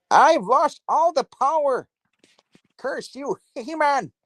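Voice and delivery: very high, slow cartoon villain lament; hammed-up high-pitched villain wail; Skeletor. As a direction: curse your nemesis in a piercing mock-villain voice. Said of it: "I sound like Skeletor".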